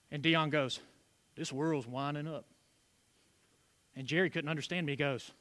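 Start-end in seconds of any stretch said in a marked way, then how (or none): 1.4-2.4 low mumble. Retold or imitated. Caller Dion mumbles, unintelligible.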